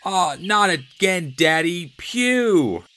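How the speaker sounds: high, loud disgusted yell; yelled complaint. A narrator's reading. Nicky yelling at his father about the smell. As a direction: yell it out, grossed out.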